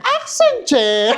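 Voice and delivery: high, drawn-out mock announcement; playful exclamation. Host joking with companion over a new word, playful exclamation. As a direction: sing out the term, pitched high and stretched. Playful repeat of newly learned term.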